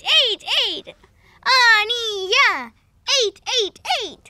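ordinary talk

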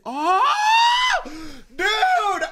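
loud, high, drawn-out exclamation; real delighted surprise; excited realization. Real, not acted. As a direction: cry out as the idea lands. Sudden realization about carrot juice.

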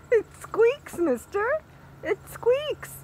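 squeaky high voice for the puppy. Real, not acted. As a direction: coo at the dog in a high sing-song. Cooing at dog over toy.

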